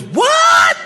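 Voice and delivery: high, loud "What?"; a stunned yelp of disbelief; shocked shout. Retold or imitated. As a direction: salesman hearing that the father owns everything. Stunned salesman reacting.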